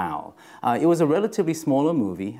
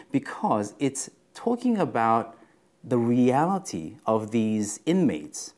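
ordinary talk

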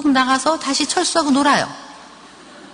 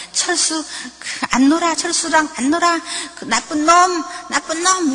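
put-on higher-pitched sulky little boy; huffing angry child; 5 semitones higher than usual. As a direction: play a five-year-old cursing his friend. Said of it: "막 씩씩거리고 들어와요".

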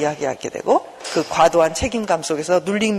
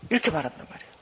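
normal talking voice

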